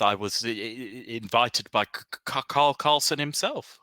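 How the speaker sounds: a light stutter on the first sounds; stuttering merchant. Acted. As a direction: stammer through the words. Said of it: "He has a very selective stutter".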